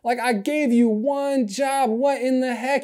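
can't believe they fell asleep; Jesus finding his disciples asleep, exasperated; exasperated disbelief. Retold or imitated. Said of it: "he's like".

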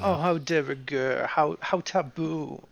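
slow, drawn-out mock-British accent. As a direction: tease with affected British vowels, slowly. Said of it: "keep doing that accent".

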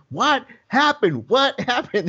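slow, high, drawn-out demand; exasperated dad. Retold or imitated. Dad on phone demanding news from son, exasperated. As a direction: imitate a fed-up father, stretching each word. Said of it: "the dad's just exasperated".

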